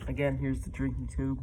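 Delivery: muffled behind the mask's rubber; through a gas mask. Real, not acted. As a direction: say "drinking tube" from inside a gas mask. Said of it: "show you guys what it's like with me in it".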